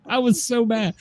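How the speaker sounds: laughing through the words. Real, not acted. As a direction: laugh while you say it. Laughing at own anger.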